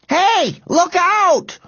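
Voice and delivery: high, alarmed shout; warning shout. A narrator's reading. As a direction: warn of an oncoming car. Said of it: "warned Robert".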